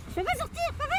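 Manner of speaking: put-on high, whiny kid calling dad; childish call. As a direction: play a stuck kid calling papa.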